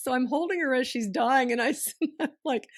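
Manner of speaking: high laughter breaking into the words; laughing through it. Real, not acted. Daughter recalls her dying mother, laughing through it.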